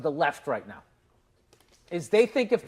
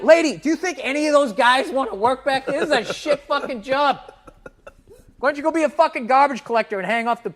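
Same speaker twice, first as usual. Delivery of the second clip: imagined retort; 9 semitones higher, 5 dB louder than usual. Pretend. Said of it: "I wanna be like".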